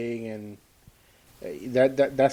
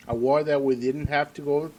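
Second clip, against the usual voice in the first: ordinary delivery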